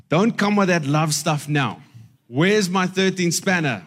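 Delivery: loud, high indignant shout; angry yell. Retold or imitated. Husband shouting at his wife to drop the love talk, loud and high. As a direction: bark it back at her, fed up. Husband yells back in story.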